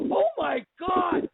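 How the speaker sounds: high, slow astonished oh my God; freaking out. Retold or imitated. On the phone with Jackie: high amazed oh my God. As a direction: cry it out, amazed. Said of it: "I was freaking out".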